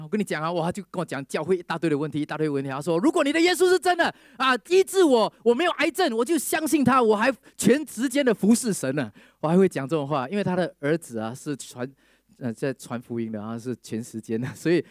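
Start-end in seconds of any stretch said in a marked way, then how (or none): 3.0-9.1 high, loud challenge to God; defiant challenge. Retold or imitated. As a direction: throw it at him as a dare, much higher and louder. Angry sick man challenging Jesus.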